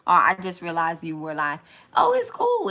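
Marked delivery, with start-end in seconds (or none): 1.9-2.7 jumps way up high, caught off guard; a quick startled brush-off, pitched high; surprised. Real, not acted. Reader realizing she's live, startled high brush-off.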